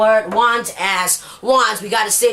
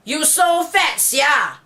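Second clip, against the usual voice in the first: Singlish; 5 semitones higher, much slower than usual. Shown as a demonstration. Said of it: "for example".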